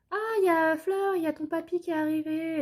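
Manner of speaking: high, bright call to a child, lightly voiced; chirpy school staff voice. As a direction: sing out to the kid that grandpa's here. School staff announcing her father.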